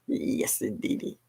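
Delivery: a laugh then a soft unvoiced yes indeedy; whispered catchphrase. Real, not acted. Host softly repeating her catchphrase after a laugh.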